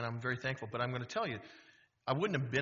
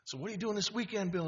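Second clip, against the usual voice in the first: friendly ask; 7 semitones higher than usual. Retold or imitated. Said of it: "Dave goes, he goes".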